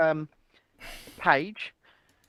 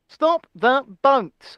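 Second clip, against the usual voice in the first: slow, deliberate slogan; mock-grave, word by word; Rishi Sunak; 3 semitones higher, much slower than usual. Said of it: "let me do my best Rishi impression".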